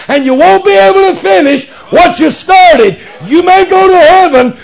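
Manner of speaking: high, pressing pulpit warning; full-force preaching. Real, not acted. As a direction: drive the warning home to the congregation.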